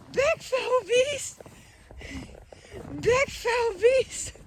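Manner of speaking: very high, slow drawn-out mock alarm; hammed-up squeaky fright; mock terror. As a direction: cry out high at a pretend monster. Pretends the dog is a monster.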